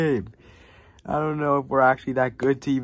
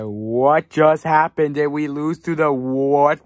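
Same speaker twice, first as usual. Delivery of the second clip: slow, loud, raised cry of disbelief; shocked disbelief; 4 semitones higher, 7 dB louder, slower than usual. Real, not acted. Fan reacts to a shock loss, drawn-out disbelief. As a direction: stretch it out in stunned outrage.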